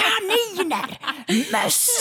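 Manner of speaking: very high, loud single-word cries; hammed-up ranting screech; mock outraged shouting. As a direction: yell each animal like an accusation. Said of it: "så började han i alla fall skrika".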